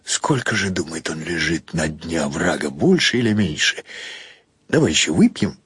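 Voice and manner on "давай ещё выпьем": ordinary delivery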